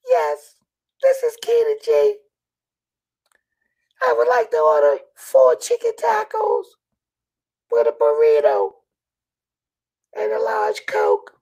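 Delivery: very high squeaky granny voice; squeaky old lady voice. Acted. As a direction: pinch it up into a tiny granny squeak. Old lady customer voiced in story.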